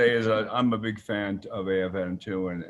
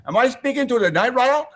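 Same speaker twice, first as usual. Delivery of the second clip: high, louder starstruck fan question; mock starstruck fan; 12 semitones higher, 6 dB louder than usual. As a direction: imitate an awestruck fan asking. Retold fan asking online.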